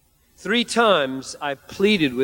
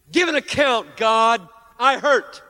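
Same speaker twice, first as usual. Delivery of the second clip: high, loud, accusing cry of hurt; demanding complaint to God; 10 semitones higher, 5 dB louder than usual. Retold or imitated. Imagined believer demanding God explain his pain, high hurt outcry.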